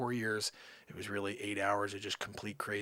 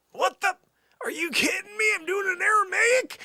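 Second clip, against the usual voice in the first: very high, loud screech of disbelief; comic high-pitched outrage; incredulous outburst; 11 dB louder than usual. Acted. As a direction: screech it at God in total disbelief. Acted outburst at God's demand.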